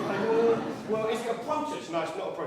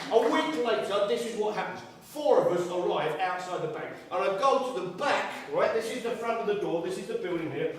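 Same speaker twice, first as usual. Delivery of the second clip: normal talking voice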